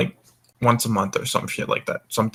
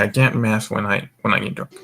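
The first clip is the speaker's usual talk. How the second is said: ordinary delivery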